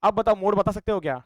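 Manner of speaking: ordinary talk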